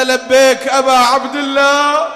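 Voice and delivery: a fervent shout of devotion; loud answering cry. Acted. Answering the call aloud.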